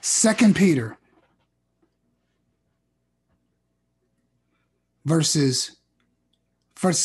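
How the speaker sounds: loud, measured pulpit reading with long pauses; preaching scripture. Acted. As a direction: proclaim each phrase to the congregation.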